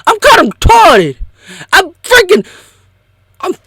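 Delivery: very high wailing shrieks; shrieking crying voice. Retold or imitated. Critic loses it completely, screaming and crying high. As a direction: shriek it to the top of your voice.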